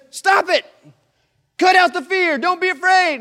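high, loud command to stop the fear; Jesus ordering his disciples to stop fearing, loud and firm; commanding shout. Acted. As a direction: bark it out like a stern teacher.

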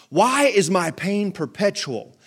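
put-on slow, grand King James accent. Preacher reading Jeremiah's lament aloud, in a King James accent. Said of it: "don't you need an accent".

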